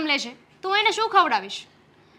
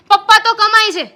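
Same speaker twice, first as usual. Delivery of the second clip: mock his excuse, louder and higher; 6 semitones higher, 8 dB louder than usual. Wife throwing husband's excuse back, mocking and louder. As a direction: sneer it right back at him.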